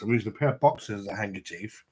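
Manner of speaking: ordinary talk, nothing marked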